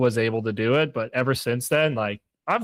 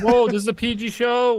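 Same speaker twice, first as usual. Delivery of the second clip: mock scolding; 11 semitones higher, 4 dB louder than usual.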